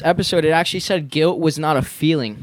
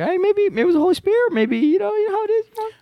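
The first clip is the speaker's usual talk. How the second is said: much higher, playful voice; coy high voice. Retold or imitated. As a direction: squeak it out coyly. Coy squeaky retelling.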